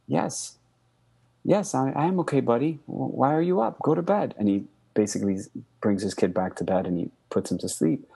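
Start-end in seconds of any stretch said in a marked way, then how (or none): 0.1-4.3 higher, gentle bedtime reassurance; dad soothing his son at three in the morning, voice raised higher; reassuring dad. Retold or imitated. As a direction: cover the fear and soothe the boy. Father hides panic from son.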